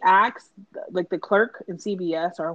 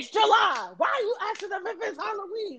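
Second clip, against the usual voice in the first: mocking mom; 11 semitones higher than usual. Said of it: "She was like".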